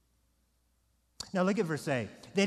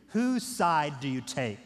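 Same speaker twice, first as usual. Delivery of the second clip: ordinary delivery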